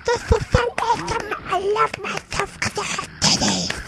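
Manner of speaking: put-on squeaky high girlish squeal; little girl voice. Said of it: "I sound like a little girl".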